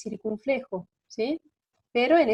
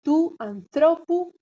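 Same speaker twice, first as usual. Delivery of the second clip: slow, high, loud anthropu, stressed on THRO; accent moved along; 6 semitones higher, 5 dB louder, slower than usual. Shown as a demonstration. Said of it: "Va a correr el acento una sílaba".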